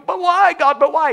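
very high, loud whiny why; whining protest. Retold or imitated. Punished person whining to God, very high and loud. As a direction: wail like a spanked child. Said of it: "God just keeps hiding you".

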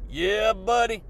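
ordinary talk

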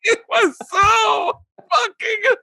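very high, slow squeal through laughing; hysterical laughter. Real, not acted. Comic telling how it happened twice, in hysterics. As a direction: fight to speak while crying with laughter.

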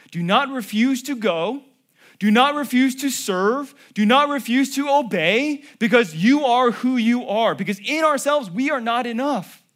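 loud, high, ringing exhortation; preaching at full force. Acted. Pastor urging his church not to refuse God, full-force preaching. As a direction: rouse the congregation to obey. Sermon climax.

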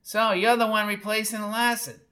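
put-on high, screechy old woman; high scratchy challenge; scratchy screech. A narrator's reading. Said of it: "blackboard scratch and a bee sting screech".

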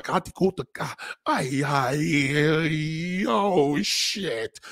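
over the top Hola and ay; mock Puerto Rican moaning. A comic imitating a Puerto Rican woman in bed. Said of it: "she gonna be like".

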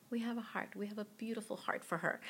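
hushed quote. Retold or imitated. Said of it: "I'll never forget Megan's words were".

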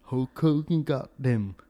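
slow, loud southern wrestler drawl; Macho Man with a southern drawl. Retold or imitated. Imitating a wrestler complaining about rivals, in a loud slow southern drawl. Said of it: "the Macho Man had a southern drawl".

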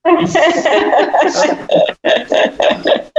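long laugh. Real, not acted. Hosts crack up on air.

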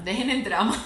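breaking into laughter; amused, laughter taking over; laughing advice. Real, not acted. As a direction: tell them off playfully, ending in a laugh. Laughing as she gives advice.

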